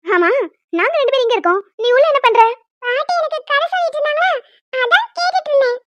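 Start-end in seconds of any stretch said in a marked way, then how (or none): 2.8-5.8 put-on high small child's voice; little girl. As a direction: answer like a little daughter calling out to mum. Daughter explains to parents. (அம்மாவிடம் பதில் சொல்லும் சிறுமியின் உயர்ந்த குரல்)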